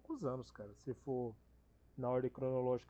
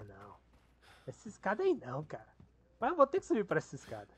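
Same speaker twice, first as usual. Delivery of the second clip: exasperated whining; 3 semitones higher, 8 dB louder than usual. Real, not acted. Refusing the scary ladder.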